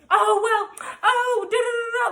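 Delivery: high, singsong mock babble; mocking the girlfriend. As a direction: mimic her prattle in a high voice. Mocking rival girl's talk.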